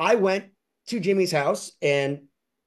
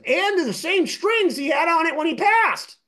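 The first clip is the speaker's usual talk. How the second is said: eager quote, pitched much higher; imitating Jimmy; 13 semitones higher, 4 dB louder than usual. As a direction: show off the stuff in his voice, up high. Quoting Jimmy about the strings.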